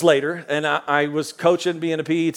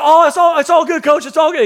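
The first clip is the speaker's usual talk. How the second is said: put-on nervous kid; 9 semitones higher, 4 dB louder than usual. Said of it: "And he was like".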